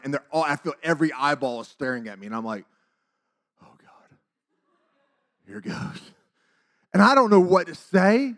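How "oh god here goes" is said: hushed "oh God" under the breath; barely voiced, bracing yourself; retold dread. Teller with every eye on him, hushed dread.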